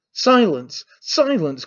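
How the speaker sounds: worked-up but restrained command; agitated cry. A narrator's reading. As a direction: cry out each word with a falling end. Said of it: "cried Stepan Trofimovitch, shaking all over".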